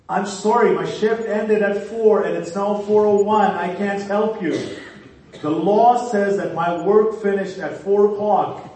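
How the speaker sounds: loud, high, stubborn refusal; mock rule-bound lifeguard. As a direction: yell the excuse like an officious lifeguard. Lifeguard refuses, citing his shift.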